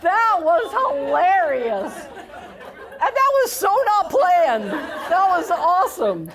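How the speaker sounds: high, loud, laughing exclamations; laughing delight. Real, not acted. Teacher laughing as the audience answers in unison. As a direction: burst out laughing at the crowd. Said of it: "That was almost in unison".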